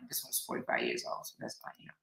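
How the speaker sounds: soft whispered muttering to self; whispered aside. Real, not acted. Checking the record, soft whispered aside. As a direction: whisper it to yourself.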